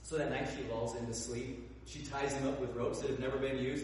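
usual speaking voice

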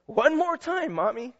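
lightly voiced child asking for story; pleading child. Child begging in tribute.

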